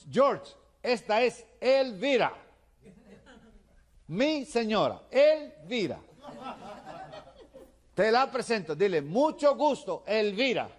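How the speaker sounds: high, slow, bellowed introduction; shouting. Acted. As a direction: shout each word at the foreigner to be copied. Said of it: "le sigue gritando".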